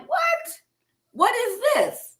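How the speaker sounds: high-pitched retold "what?", drawn out; shocked disbelief. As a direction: react stunned, voice leaping up high. Retold stunned reaction to diagnosis.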